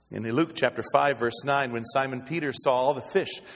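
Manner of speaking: usual speaking voice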